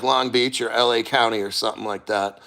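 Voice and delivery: normal talking voice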